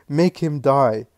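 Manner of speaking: grieving mother's last plea to the Lord; desperate final plea. A narrator's reading. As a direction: drag it out like a last desperate prayer. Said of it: "He lives in agitation".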